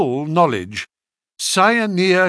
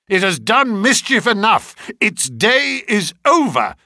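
high, raised-voice declaration; impassioned cry; 6 semitones higher, 4 dB louder than usual. A narrator's reading. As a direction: cry out in righteous resolve. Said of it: "I cried".

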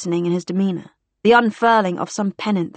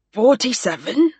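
slow, higher anxious query; hoarse, anxious; 4 semitones higher than usual. A narrator's reading. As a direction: ask slowly, rising, with a touch of alarm. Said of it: "in a hoarse, anxious voice".

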